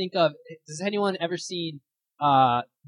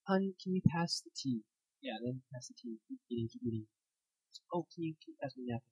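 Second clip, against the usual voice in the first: hushed, polite voices passing food; quiet dinner-table murmur; 11 dB quieter than usual. Retold or imitated. Family at a tense dinner table, mild hushed asks. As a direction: keep it small and mannerly, like a silent dinner.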